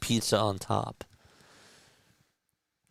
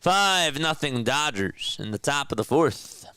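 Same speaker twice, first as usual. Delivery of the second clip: play-by-play call. Acted. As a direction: call the count like a TV broadcaster. Live play-by-play of his game.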